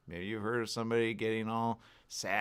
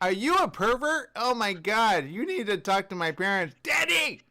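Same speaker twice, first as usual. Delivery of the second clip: put-on high, loud outraged child voice; mock kid yelling; 12 semitones higher, 8 dB louder than usual. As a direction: shout for daddy like a scared kid. Pretend kid yelling at caller.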